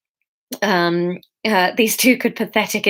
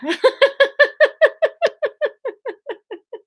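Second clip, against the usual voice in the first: real giggling fit; high laughter at a failed accent; high helpless laugh. Real, not acted. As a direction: crack up at your own accent. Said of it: "Oh, dear".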